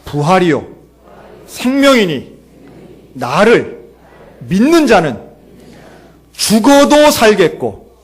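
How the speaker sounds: loud declamation in short, separated phrases; recitation for repeating. Shown as a demonstration. Preacher leads congregation through Jesus's words, loud phrase-by-phrase declamation. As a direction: proclaim phrase by phrase, pausing after each. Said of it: "한번 따라할까요?".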